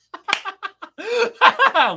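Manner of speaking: real high-pitched laugh bursting out; high burst of laughter. Real, not acted. Streamer laughs at chat stalling Maritime, high laugh.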